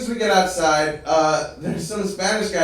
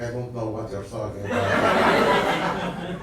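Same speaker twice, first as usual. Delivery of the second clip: put-on low, slow Arabic-accented bafflement; slow, lowered mock-accent denial; baffled shopkeeper; 8 semitones lower, slower than usual. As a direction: imitate a stone-faced Arabic shopkeeper.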